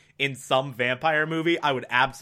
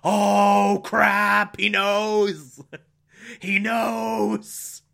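hammed-up, higher-pitched dread; playacting a spy about to be found out; mock panicked freak-out; 4 semitones higher, much slower than usual. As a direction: stretch it out in exaggerated horror. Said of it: "basically season one Philip and Elizabeth reactions of".

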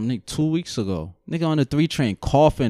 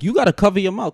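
higher-pitched, exasperated telling-off; insistent retold scolding; 7 semitones higher, 4 dB louder, faster than usual. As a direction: press the demand like a fed-up passenger. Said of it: "'cause my whole thing is like".

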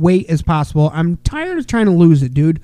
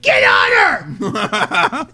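slow stretched-out yell; drawn-out shout; much slower than usual. Retold or imitated. Dad shouting an order to his daughter, drawn out. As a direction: drag it out, calling from the sidelines. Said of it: "I'm like".

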